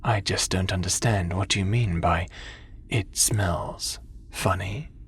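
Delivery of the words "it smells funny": slow whispered mock quote. Teasing a friend by whispering his words back slowly.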